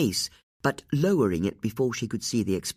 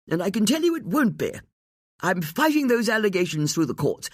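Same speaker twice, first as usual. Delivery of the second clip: indignant; 9 semitones higher than usual. A narrator's reading. Angry lord defending himself.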